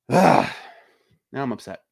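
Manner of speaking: ordinary delivery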